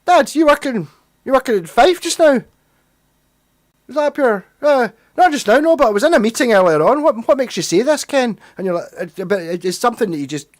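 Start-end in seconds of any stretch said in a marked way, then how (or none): 0.1-2.4 put-on high, loud Fife-accented question; Fife dad-talk accent quote. Asking dad over dinner about work, exaggerated Fife accent. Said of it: "he would have the A's and the Kens". 3.9-8.4 high, loud imitation of dad's Fife lilt; dad's Fife accent. Dad answering at the dinner table, imitated Fife accent. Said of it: "just in his voice".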